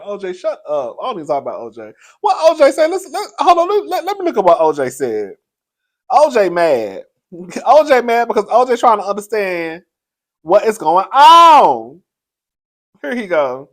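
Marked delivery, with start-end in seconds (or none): 2.2-5.4 high, loud burst with laughter in it; laughing, pitch leaping up then settling; excited disbelief. Real, not acted. She spots O J's post online, excited disbelief. 6.1-12.0 loud, raised, teasing delivery; amused mockery. Real, not acted. She teases O J for being mad, amused mockery. Mocking O J's reaction, laughing.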